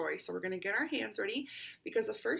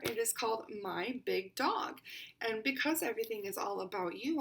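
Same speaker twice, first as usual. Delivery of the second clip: plain reading voice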